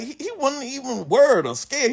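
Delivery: ordinary delivery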